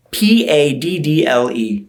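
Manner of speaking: slow letter-by-letter spelling. Shown as a demonstration. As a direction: spell out each letter of PADDLE. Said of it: "I'm going to spell it for you".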